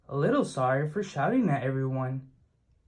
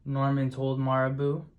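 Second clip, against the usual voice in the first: ordinary delivery, nothing marked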